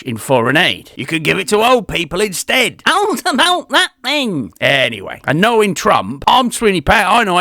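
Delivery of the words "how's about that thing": squeaky high silly voice; a high cartoonish voice; put-on voice. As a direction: pop up in a high comic voice. Sudden put-on voice.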